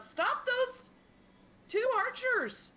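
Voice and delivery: high, drawn-out battle shout; hollered command. Acted. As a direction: holler an order across the battlefield. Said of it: "hollers out".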